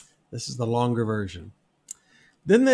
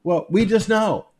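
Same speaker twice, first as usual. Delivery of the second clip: higher, smug shrug of a reply; mock smug; 6 semitones higher, 4 dB louder than usual. Preacher mimicking an accuser with no answer, smug. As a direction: play it pleased with yourself.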